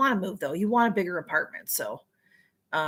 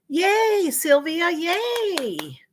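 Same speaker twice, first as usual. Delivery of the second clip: cheering; 7 semitones higher, much slower than usual. Real, not acted. Cheering Sylvia's good news.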